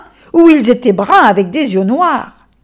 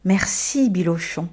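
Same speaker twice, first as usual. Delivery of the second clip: whispered, much softer thanks; 4 semitones lower, 7 dB quieter than usual. Acted. L'enfant remercie l'âne à l'oreille, murmuré. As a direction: breathe it gently into his ear. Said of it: "lui dit doucement d'une voix claire".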